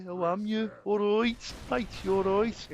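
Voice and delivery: a Brummie trying, sliding Scouse; Brummie accent again. Pretend. Repeating the greeting in a Brummie accent. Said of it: "it sounded more bloody Scouse than Brummie".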